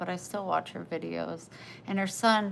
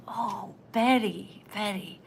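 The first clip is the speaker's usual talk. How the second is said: slow, louder, in a changed voice; delighted old woman; 5 dB louder than usual. Retold or imitated. Betty greeting her own reflection, slow and delighted. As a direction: savour the name, delighted. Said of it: "And she goes".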